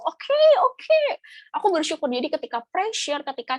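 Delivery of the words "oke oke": high, loud mock surrender; much higher, louder acted reply; retold giving in. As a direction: squeal out a put-on agreement. Acts out giving in to God.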